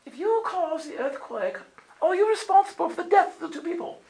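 high mock female voice posing the question; 2 questioning lady. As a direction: mimic the audience lady asking, pitched high. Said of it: "the lady right here says".

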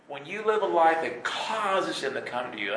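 normal talking voice

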